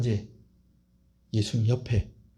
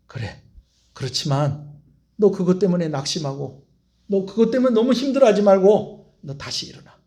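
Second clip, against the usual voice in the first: Jesus consoling Peter. Acted. Jesus gazing at fallen Peter, tender then pleading. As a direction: comfort him, then press him to return.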